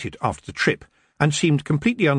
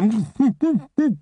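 high, chuckling fairy laugh; a cheeky wee laugh; pixie giggle; 12 semitones higher than usual. Acted. Feagle laughs after teasing.

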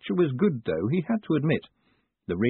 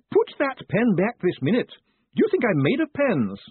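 louder, high scolding clerk; ringing command; 11 semitones higher, 4 dB louder than usual. A narrator's reading. Postmistress scolding a customer, louder and high. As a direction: bark at the pen thief.

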